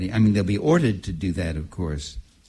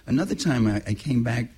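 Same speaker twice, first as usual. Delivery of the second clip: ordinary delivery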